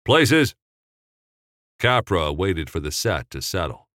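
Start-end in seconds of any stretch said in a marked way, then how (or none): 0.0-0.5 short loud high call; calling places. Acted. Assistant director calling the crew to places, loud.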